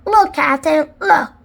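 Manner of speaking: loud, rising excitement; excited shout. A narrator's reading. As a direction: call out a find. Pinky excitedly calling to captain.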